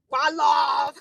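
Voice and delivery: loud, drawn-out slogan shout; shouted cheer. Shown as a demonstration. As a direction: call it out like a rallying cry.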